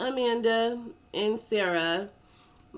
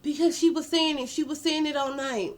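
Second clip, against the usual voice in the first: a higher, whiny defensive voice; Edwin's excuse; 7 semitones higher than usual. Retold or imitated. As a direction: squirm as you explain. Edwin answers the sergeant.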